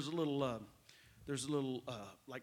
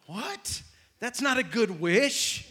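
higher, louder, slowed mock puzzlement; mock confused audience; 5 semitones higher, 7 dB louder, slower than usual. Preacher mimicking the congregation missing the joke, mock puzzled. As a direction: play the baffled listener, hammed-up. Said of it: "You're like".

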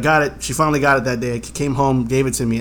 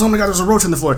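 alarmed dad; 6 semitones higher, much faster than usual. Retold or imitated. Dad's alarm retold in story.

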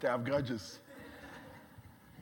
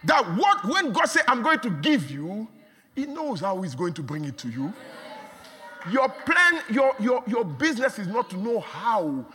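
preaching at full force; 5 semitones higher, 5 dB louder than usual. Acted. Preacher assuring the church God brings it, full force. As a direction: drive the promise home to the crowd.

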